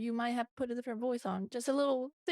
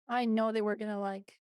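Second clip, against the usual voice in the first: ordinary talk, nothing marked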